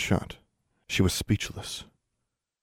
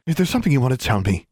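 higher, quick, irritated question; annoyed demand; 6 semitones higher, 4 dB louder, faster than usual. A narrator's reading. Annoyed mother questioning her daughter, demanding. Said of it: "she demanded".

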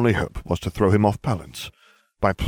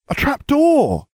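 much higher, louder excited cry; 6 dB louder, slower than usual. A narrator's reading. Hermione spots the trap door, crying out excitedly. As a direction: shout out the discovery. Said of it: "Hermione cried".

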